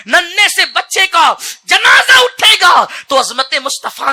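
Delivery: high, loud fiery sermon; preaching at full force. Acted. Preacher urging parents, roaring high and loud. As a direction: build the crowd with a roar.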